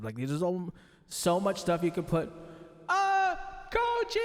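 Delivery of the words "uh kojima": big cavernous echo on a high voice; reverb effect. Shown as a demonstration. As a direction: call it out high into heavy reverb. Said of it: "let's just put on reverb".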